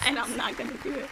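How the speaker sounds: speaking through laughter; laughing. Real, not acted. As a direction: giggle the words out.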